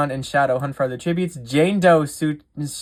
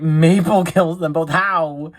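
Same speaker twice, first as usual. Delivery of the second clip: louder, laugh-tinged astonishment; amused disbelief; 3 semitones higher, 5 dB louder than usual. Real, not acted. Game host reacting to a double kill, laughing in disbelief. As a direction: read it out cracking up, astonished.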